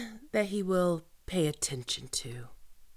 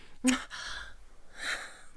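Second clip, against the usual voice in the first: soft wicked chuckle; 6 semitones higher, 7 dB quieter than usual. Acted. Villain amused.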